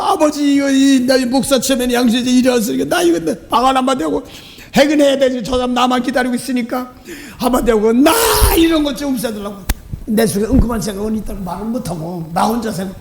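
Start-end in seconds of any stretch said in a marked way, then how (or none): 0.0-9.6 shouted prayer. Retold or imitated. Loud prayer retold to congregation.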